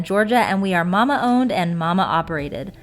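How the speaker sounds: upbeat sponsor read, loud. Acted. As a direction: read it like a commercial.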